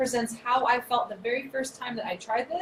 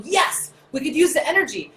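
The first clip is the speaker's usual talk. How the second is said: eager cells; 6 semitones higher, 6 dB louder than usual. Acted. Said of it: "the cells go".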